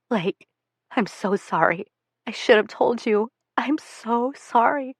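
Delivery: soft, tearful apology from a weeping mother; a mother crying as she begs forgiveness. Acted. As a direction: plead through tears, quietly. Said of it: "She's crying".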